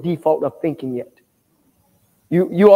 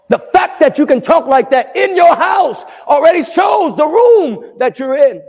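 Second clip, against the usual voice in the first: high, full-force pulpit shout; preaching shout; 8 semitones higher than usual. Real, not acted. Preacher rebuking talk at home, high preaching shout. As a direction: shout the rebuke, stretching the last words.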